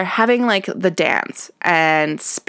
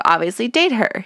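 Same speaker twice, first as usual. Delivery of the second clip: usual speaking voice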